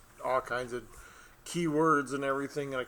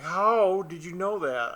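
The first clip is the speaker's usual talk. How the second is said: astonished; 6 semitones higher, 7 dB louder, slower than usual. Retold or imitated. Astonished slow quote.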